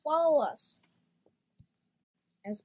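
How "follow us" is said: higher, much louder call; hollering. A narrator's reading. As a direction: holler it across the room. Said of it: "they hollered".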